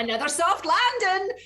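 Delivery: high, loud, cheery in-game callout; Horizon. Acted. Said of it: "she wasn't just like".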